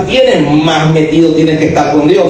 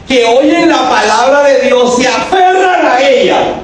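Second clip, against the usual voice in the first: high, slow, shouted scripture; proclaiming shout; 7 semitones higher than usual. Acted. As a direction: call the verse out to the church. Preacher proclaims scripture verse. (proclamado a gritos, lento y agudo)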